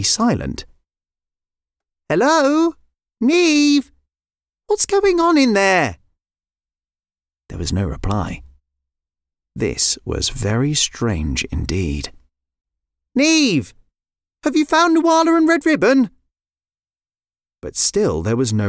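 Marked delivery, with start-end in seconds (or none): 2.1-6.0 high, raised call. Acted. Lyra calls to her sister inside the coop, calling out. 13.1-16.1 high, raised call. Acted. Lyra calls to her sister inside the coop, calling out.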